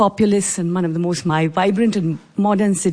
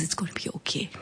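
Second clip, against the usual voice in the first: mother's near-whisper, very soft; soft, whispered comfort; hushed reassurance; 2 semitones lower, 12 dB quieter, faster than usual. Retold or imitated. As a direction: soothe a hurt child in a whisper. Mother comforts crying child.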